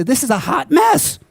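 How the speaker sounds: high, loud excited imitation of J C's accent; gushing hot-mess catchphrase in a borrowed accent; J C impression. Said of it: "that was his accent, too".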